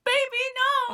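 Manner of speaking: very high, loud pleading wail; mock anguished cry. Fan reacting to strong Dean upset, hammed-up anguish. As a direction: cry out as a distraught, doting fan.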